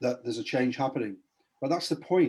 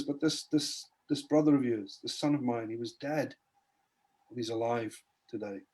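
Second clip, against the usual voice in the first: much softer, tender pleading; gentle father; 5 dB quieter than usual. Retold or imitated. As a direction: softly win the angry son back over. Father gently answers jealous son.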